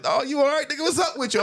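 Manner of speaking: high-pitched mock concern; retold alarm. Homie lowering his arms for him, baffled concern.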